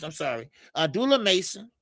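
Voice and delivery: normal talking voice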